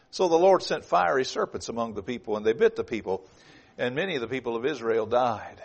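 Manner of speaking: slow, loud, high preaching read; fervent scripture reading. Acted. Preacher reading Numbers to congregation, loud and slow. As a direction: proclaim the verse with full force.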